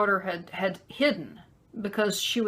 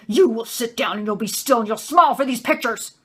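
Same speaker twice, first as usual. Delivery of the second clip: loud, menacing imitation of a raging dad; angry husband yelling; 3 semitones higher, 7 dB louder than usual. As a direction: order the child about at a forceful yell. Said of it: "that's how he yelled".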